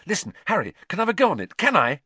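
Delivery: high, loud, eager begging; impatient pleading. A narrator's reading. Boy asking friend for a go on the broom, impatient. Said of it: "said Ron impatiently".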